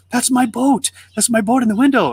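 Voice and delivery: put-on high, excited kid insisting; urgent claim. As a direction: act out a boy crying out his claim. Boy spots his lost boat.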